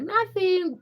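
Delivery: high, light brush-off; playing it cool. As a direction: say it lightly, as if all is fine. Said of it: "trying to play it cool".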